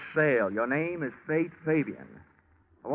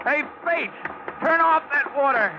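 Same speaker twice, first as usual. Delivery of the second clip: loud, much higher calling across the roof; shouting over water; 13 semitones higher, 4 dB louder than usual. Acted. Detective shouts to partner over the fire hose roar.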